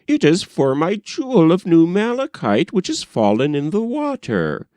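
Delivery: the king mimics the little rower; king imitating the maiden. King retells the girl's words.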